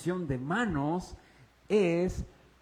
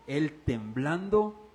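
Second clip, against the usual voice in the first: very slow, drawn out; slow trembling reading; 4 semitones lower, slower than usual. Shown as a demonstration. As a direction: linger on the fear. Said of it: "leído con temblor". (lectura muy lenta y temerosa)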